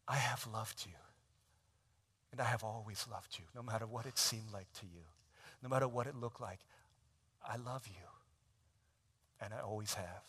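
hushed and tender, nearly unvoiced at the start; soft whisper. Father reassures his daughter, hushed tender voice. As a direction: murmur it like a secret promise.

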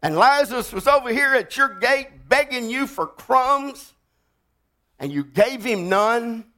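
fiery preacher calling for a witness; preaching at full force. Acted. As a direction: bring the congregation to its feet. Preaching rich man's fate.

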